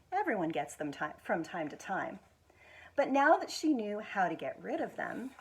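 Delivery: gentle, expressive picture book reading; reading to children. Acted. School foundation director reading a picture book to children, expressive storytelling. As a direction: tell it like bedtime story time.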